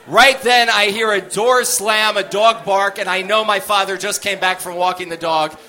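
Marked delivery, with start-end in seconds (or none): none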